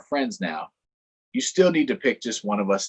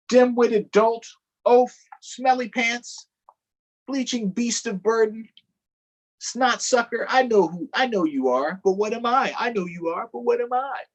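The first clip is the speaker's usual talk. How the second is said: high, hammed-up name-calling; mock squabble; 11 semitones higher, 4 dB louder than usual. Donkey and Elephant calling names, high mock squabble. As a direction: trade playground insults.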